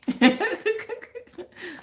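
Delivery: bright burst of laughter; delighted high laugh. Real, not acted. Laughs at own quote.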